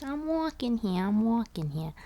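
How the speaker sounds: put-on New York accent. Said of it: "And he goes".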